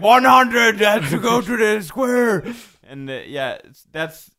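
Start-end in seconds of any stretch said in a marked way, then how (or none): 0.0-2.4 high, loud, slow decree; loud proclamation. Retold or imitated. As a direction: announce it like a herald. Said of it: "you'll be like".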